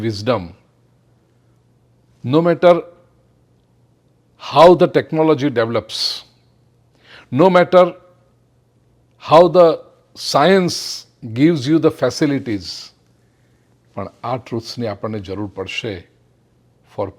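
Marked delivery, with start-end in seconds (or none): 2.2-12.9 higher and louder, slow emphatic sermon build; forceful preaching. Acted. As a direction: drive the point home from the pulpit. (જોરદાર પ્રવચનના ભારથી, ઊંચા અને મોટા અવાજે)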